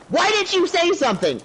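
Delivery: high-pitched mock accusation; scolding wife. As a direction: imitate a wife blaming her husband. Acted wife scolding her husband.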